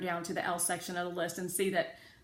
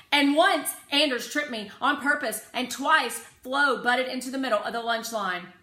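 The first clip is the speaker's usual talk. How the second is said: insistent tattling; 6 semitones higher, 11 dB louder than usual. A narrator's reading. Rose keeps listing rule-breakers.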